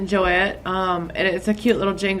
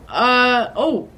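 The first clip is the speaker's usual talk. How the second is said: usual speaking voice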